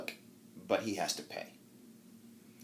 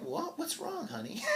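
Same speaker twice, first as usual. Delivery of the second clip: high, soft, smiling innocent question; teasing sweetly; 7 dB quieter than usual. Acted. Wife teasing her fidgeting husband, high and sweet. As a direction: play innocent with a big smile. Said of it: "with this big smile on her face".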